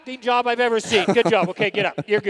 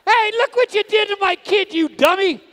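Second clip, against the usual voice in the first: mock outrage; 7 semitones higher than usual. Clown scolds announcer again.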